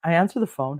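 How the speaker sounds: usual speaking voice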